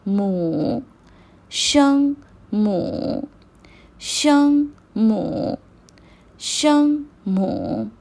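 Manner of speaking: slow, clear model of शंग मो for students to repeat; shengmu, slow teacher drill. Shown as a demonstration. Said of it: "initials को Chinese language में कहते हैं शंग".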